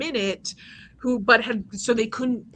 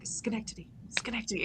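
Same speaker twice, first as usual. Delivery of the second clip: quiet answer; 11 dB quieter, faster than usual. Retold or imitated. Answering trivia quietly to Aaron.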